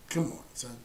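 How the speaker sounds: ordinary talk, nothing marked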